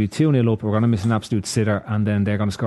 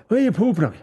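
rural Irish country accent, hammed up; mock it in a thick Irish country accent; 10 semitones higher, faster than usual. Shown as a demonstration. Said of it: "maybe if we try it in a country accent".

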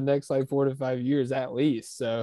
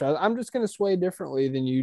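normal talking voice

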